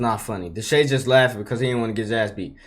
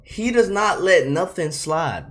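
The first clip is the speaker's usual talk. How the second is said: slow and stressed, every word hit; firm, slow insistence; emphatic; 5 semitones higher, slower than usual. Real, not acted. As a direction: drive the point home. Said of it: "I'm telling you".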